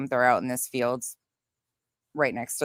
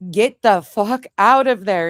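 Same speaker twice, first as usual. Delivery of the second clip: louder, higher, slow and emphatic; urgent retold shout; 5 semitones higher, 7 dB louder than usual. Woman warns partner beside elk in ditch, urgent mock shout. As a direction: hammer out a mock-frantic warning.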